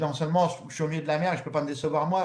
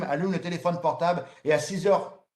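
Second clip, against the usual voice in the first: ordinary talk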